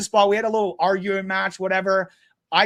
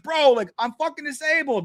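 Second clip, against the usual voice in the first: retold call out; 7 semitones higher than usual. Shouting at driver while honking.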